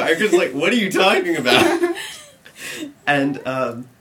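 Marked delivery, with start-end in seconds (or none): 0.5-1.9 loud, high retold denial with laughter; mock protest. Accused driver answering the cop, loud indignant protest. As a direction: protest loudly, imitating the accused friend.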